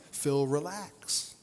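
slow, soft "Phil, relax"; hushed friendly comfort; calm reassurance. Retold or imitated. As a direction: settle him down gently. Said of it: "He was essentially saying".

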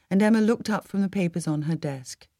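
ordinary delivery, nothing marked

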